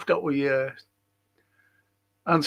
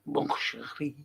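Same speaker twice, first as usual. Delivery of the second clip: put-on soft, lower French accent; hushed attempt at a French accent; 3 semitones lower, 9 dB quieter than usual. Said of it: "Is that a good accent?".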